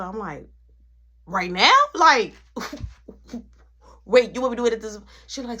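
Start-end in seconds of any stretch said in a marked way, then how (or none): 1.2-5.0 startled disbelief. Retold or imitated. Shocked at the ultimatum.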